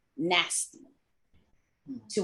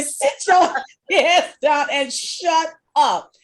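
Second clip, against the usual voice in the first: higher, louder, slow, laughing through it; hammed-up bossy shout; mock annoyed yell; 7 semitones higher, 8 dB louder, slower than usual. Line sisters ordering her to sit down, laughing mock yell. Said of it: "They were".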